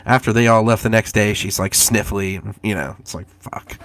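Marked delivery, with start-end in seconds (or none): none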